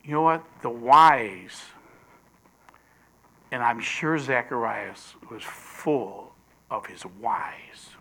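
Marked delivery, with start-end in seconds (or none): 3.5-7.9 hushed whisper. Acted. Preacher musing on Zacharias's doubts, whispering. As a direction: drop to a breathy whisper, lower.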